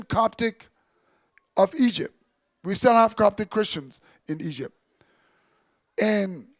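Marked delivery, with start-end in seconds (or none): none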